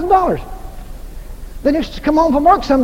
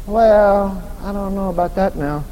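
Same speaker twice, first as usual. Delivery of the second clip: low, soft doubting voice; doubtful church member; 7 semitones lower, 6 dB quieter than usual. Pretend. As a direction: mutter like a skeptic. Mock doubter's reply.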